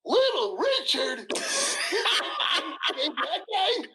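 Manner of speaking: the father can't believe his ears, stretch it out; incredulous dad. Retold or imitated. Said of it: "He goes".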